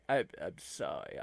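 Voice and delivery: usual speaking voice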